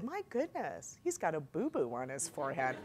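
soft motherly concern, acted out; mommy voice. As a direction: coo quietly over a little boy's boo-boo. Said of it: "my mommy eyes said".

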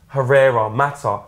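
ordinary delivery

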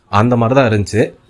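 normal talking voice

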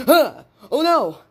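high, gasping alarm; shocked dismay. Acted. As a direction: cry out in acted shock at the loss. Acts discovering missing eggs.

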